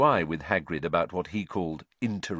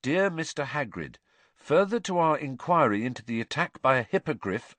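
higher-pitched formal letter reading; reading aloud; 5 semitones higher than usual. A narrator's reading. Harry reads Hagrid the official letter, formal reading aloud. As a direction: read out an official letter in a raised, measured voice. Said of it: "read aloud".

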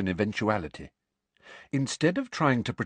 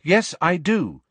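slow, high and louder, standing firm; clear, carrying voice; 9 semitones higher, 5 dB louder than usual. A narrator's reading. Pupil stands by her view to teacher, clear carrying voice. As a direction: project it deliberately, like a bold pupil. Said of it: "speaking in a clear, carrying voice".

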